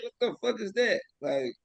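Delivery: ordinary talk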